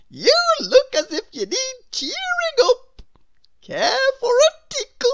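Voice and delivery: very high, loud, giggly character; Mister Tickle. A narrator's reading. Mister Tickle offers a tickle, high and gleeful. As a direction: bubble over with cheerful mischief. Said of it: "Mister Tickle came along".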